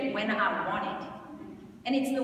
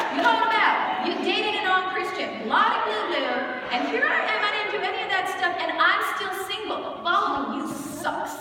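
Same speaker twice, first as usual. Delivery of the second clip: higher, louder rant, half laughing; venting at God; 8 semitones higher, 6 dB louder than usual. Retold or imitated. Ranting to the Lord about staying single, high loud exasperated rant. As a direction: let the unfairness spill out fast and loud. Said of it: "people are like".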